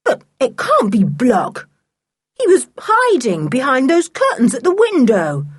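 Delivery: put-on boy protesting to his stepfather. A narrator's reading. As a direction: push back against the stepfather. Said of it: "protested Sooty".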